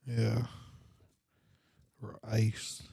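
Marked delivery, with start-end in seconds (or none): none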